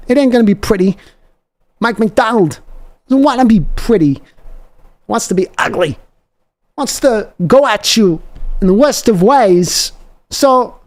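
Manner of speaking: slow, high, drawn-out rallying cry to the faithful; fired-up fan pep talk. Acted. Host rallying anxious Seahawks fans before the win, fired-up slow preaching. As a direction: preach it to the fans like a hype man.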